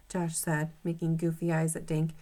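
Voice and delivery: usual speaking voice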